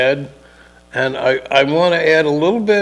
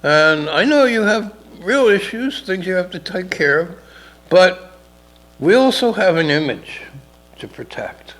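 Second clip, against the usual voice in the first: mayor voice; 7 semitones higher, 4 dB louder than usual. Retold or imitated. Mayor pressing chief in story.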